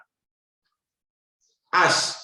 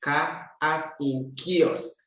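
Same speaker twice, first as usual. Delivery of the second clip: normal talking voice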